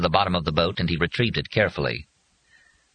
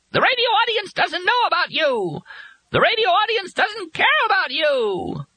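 piercing, extremely high bird screech; wild shrieking. A narrator's reading. Bird taunting from the sky, wildly shrilling. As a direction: shriek in determined bursts. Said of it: "shrieking in wild, determined bursts".

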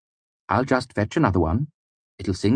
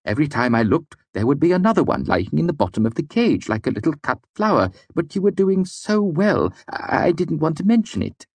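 rising higher, ever faster anxious confession; words tumbling faster; 4 semitones higher than usual. A narrator's reading. Servant confesses to his master, tumbling faster and higher. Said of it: "his words came faster and faster".

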